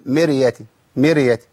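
مرية as "mereya", extra vowel after ر; wrongly vowelled ر. Shown as a demonstration. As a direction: slip a short vowel into the ر of مرية. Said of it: "ما حركش الراء".

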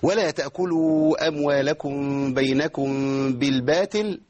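chanted verse ending on a light t in بالباطل; weakened ta. Shown as a demonstration. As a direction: recite slowly, softening ط to ت in بالباطل. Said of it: "إيه بالباطل دي؟".

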